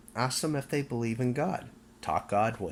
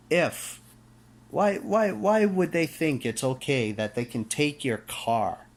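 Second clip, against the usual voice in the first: rising outrage; 6 semitones higher, 4 dB louder than usual. Real, not acted. Ranting about seized cars.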